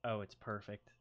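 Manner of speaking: ordinary talk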